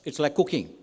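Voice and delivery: ordinary talk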